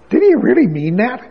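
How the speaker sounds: high, questioning, incredulous. Acted. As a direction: voice the doubter's challenge. Voicing doubting questions.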